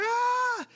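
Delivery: put-on sudden high yelp of a upset toddler; child's scream. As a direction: let out a short high cry like a hurt kid. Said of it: "He let out a scream".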